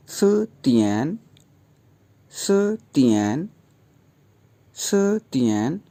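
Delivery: सतियन drawn out slowly, second tone rising then third tone dipping; slow tone drill. Shown as a demonstration. Teacher slowly modelling सतियन for students. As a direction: stretch सतियन out, syllable by syllable. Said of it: "तो यूँ pronounce होगा".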